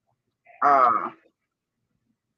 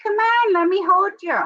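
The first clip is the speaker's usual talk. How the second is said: much higher, coaxing sing-song; coaxing pet voice. Retold or imitated. Chasing the rooster round the coop, high coaxing pet voice. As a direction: coo at the rooster as if to a baby. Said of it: "I'm like".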